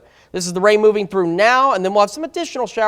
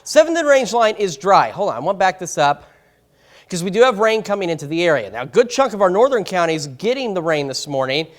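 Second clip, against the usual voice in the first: normal talking voice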